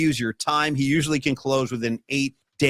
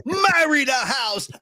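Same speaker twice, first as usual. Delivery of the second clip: Andrew Dice Clay; 8 semitones higher, much slower than usual. Pretend. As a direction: bellow it like a cocky stand-up. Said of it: "Let me deliver it like Andrew Dice Clay".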